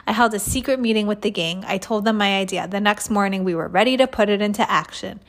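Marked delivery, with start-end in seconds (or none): none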